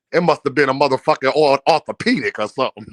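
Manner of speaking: low, fast mock Senior quote; imitating Senior. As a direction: rattle it off low and quick like Senior. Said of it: "He said".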